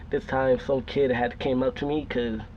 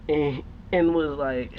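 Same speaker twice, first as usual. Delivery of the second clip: usual speaking voice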